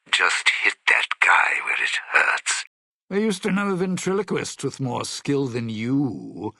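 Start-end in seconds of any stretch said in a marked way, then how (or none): 0.1-2.6 urgent loud whisper. Acted. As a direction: hiss it out as a whispered aside. Aside under the speaker voice.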